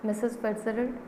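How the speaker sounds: normal talking voice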